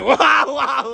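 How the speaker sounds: high, loud mock yells through laughter; laughing mock shouts; imitated shouting. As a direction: re-enact someone's scream, laughing. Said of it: "Hindi ka sumigaw!".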